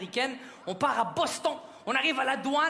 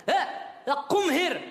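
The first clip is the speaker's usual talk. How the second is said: put-on high, punchy accented call; father calling out in accented English; father's English; 5 semitones higher, much slower than usual. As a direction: summon someone with a raised, pushed voice. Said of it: "je lui dis".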